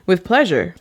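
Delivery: ordinary delivery, nothing marked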